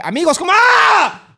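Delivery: high, loud startled scream cutting off a greeting; mock fright. Streamer imagining himself scared of doors, hammed-up loud shriek. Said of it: "estaría todo el tiempo de".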